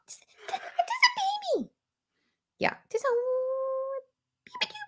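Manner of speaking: tiny, very high, drawn-out squeak; baby talk. Pretend. Vlogger applying a baby amount of blush, squeaky baby talk. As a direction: squeak it like a teeny cutesy thing.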